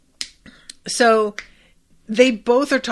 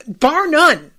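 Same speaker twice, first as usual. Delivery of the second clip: emphatic repeat; 4 semitones higher, 6 dB louder than usual. Real, not acted.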